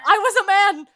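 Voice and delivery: loud, high cry of dismay; exclaimed realisation. Real, not acted. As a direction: shout it as the truth hits you. Exclaims at remembering the disguise.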